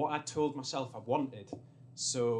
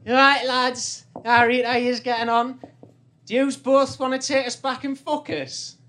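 put-on high, loud, slurry drunk girl voice; 10 semitones higher, 13 dB louder than usual. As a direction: shout it like a drunk girl on a night out. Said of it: "And she goes".

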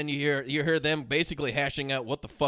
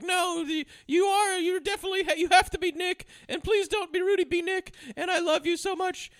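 much higher, hammed-up pleading girlfriend; mock pleading woman; 14 semitones higher than usual. High pleading voice of Charlize begging Affleck to believe her. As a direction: beg sweetly in a high mock voice. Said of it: "but she's like".

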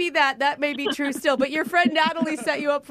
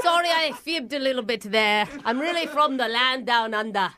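broad, hammed-up Aussie; mock Australian confession; fake Australian accent; 2 semitones lower than usual. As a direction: put on an easygoing Australian accent. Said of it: "Are you from Australia?".

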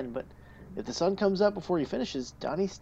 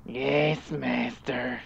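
servile Igor voice; 3 semitones lower, 5 dB quieter, much slower than usual. Acted. Igor answers his master.

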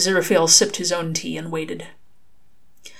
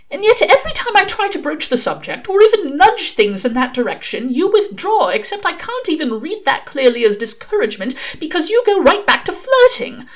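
much higher, louder exasperated angel; frustrated angel; 12 semitones higher, 6 dB louder than usual. Acted. Angel asks Crowley why he withdraws, higher and frustrated. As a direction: demand an answer, rising exasperation.

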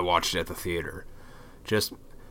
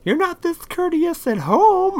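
much higher and slower, put-on teasing voice; mock wife voice; 7 dB louder, slower than usual. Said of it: "was like".

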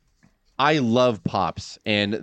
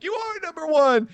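announcer voice. Retold or imitated. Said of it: "Monsoon's like".

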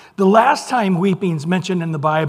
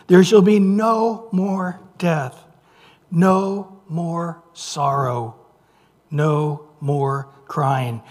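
slow, deliberate weight on each "no more"; emphatic reading; slower than usual. Acted. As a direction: spell out "no more" slowly, word by word, letting it fall. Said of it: "Could he get any more, uh, emphatic".

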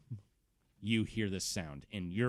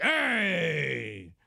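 high, loud hearty greeting; cheerful uncle greeting; 8 semitones higher, 6 dB louder than usual. Acted. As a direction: boom a happy hello.

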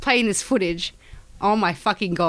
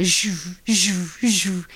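imitated lightsaber swings; buzzy play-fight sword swooshes; lightsaber whooshes; much slower than usual. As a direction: mouth three swooshing lightsaber sounds.

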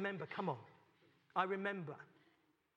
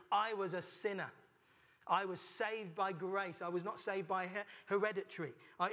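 normal talking voice

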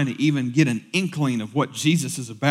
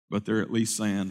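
ordinary delivery

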